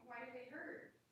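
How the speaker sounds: heard from another room, faint and distant; mother gently asking her son from afar; off mic, far away. Real, not acted. Mom checking on her crying son, far from the mic. Asks son about his legs.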